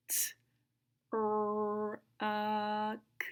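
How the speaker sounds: separated sounds with pauses; super slow sounds. Shown as a demonstration. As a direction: sound it out one by one, stretching "R" and "A". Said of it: "say the sound super slow".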